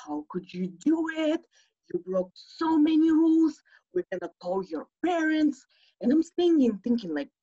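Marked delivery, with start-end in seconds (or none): 0.1-5.7 a touch of furious counselor, imitated; retold angry scolding. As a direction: scold the whole cabin, outraged. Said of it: "he's pissed".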